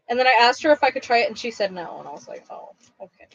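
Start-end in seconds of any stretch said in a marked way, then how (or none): 2.5-3.3 barely audible, voiceless murmur; mock-meek acceptance, almost whispered; small deflated reply. Retells disappointed reply to sister's no.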